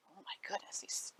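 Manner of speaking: hushed storyteller whisper; whispered aside. A narrator's reading. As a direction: breathe it to the child in suspense. Narrator gasps aside to listener.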